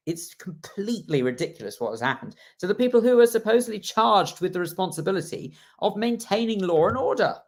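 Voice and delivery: ordinary talk, nothing marked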